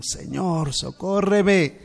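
socórreme cried high, loud and slow; desperate plea. A narrator's reading. Kneeling mother begging the Lord, loud drawn-out plea.

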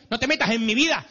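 put-on sulky teen protest, vida dropping; teen complaint. Teenager telling his mother to stay out, whiny. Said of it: "nos dicen esas cosas".

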